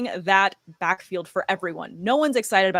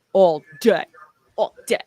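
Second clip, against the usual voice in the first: deep voice; 6 dB louder than usual. Pretend. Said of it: "are you trying to do a really cool voice".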